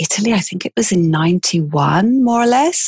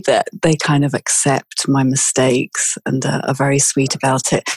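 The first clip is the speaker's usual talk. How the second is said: ordinary talk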